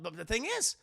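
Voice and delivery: ordinary delivery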